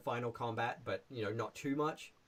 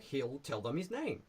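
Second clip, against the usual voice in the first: ordinary delivery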